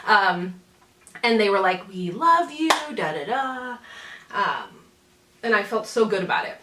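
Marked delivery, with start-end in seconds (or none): none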